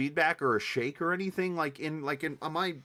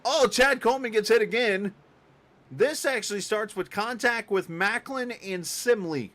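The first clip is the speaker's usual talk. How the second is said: loud, much higher wreck call; excited play-by-play; 9 semitones higher, 7 dB louder than usual. Acted. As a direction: shout the crash as it unfolds. Calling a big wreck live.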